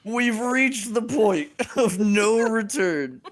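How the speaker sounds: slow, half-laughing doom; mock dramatic. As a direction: play up a mock grave despair. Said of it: "and I said".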